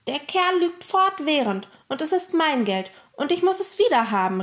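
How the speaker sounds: high, urgent protest; crying out. A narrator's reading. Emil demanding his money back, crying out in protest. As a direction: insist hotly, voice pitched well up. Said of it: "rief Emil".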